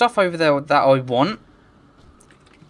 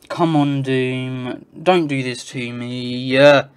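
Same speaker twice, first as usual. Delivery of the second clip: ordinary delivery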